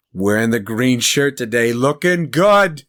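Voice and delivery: teasing heckler. Retold or imitated. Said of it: "he'll see some".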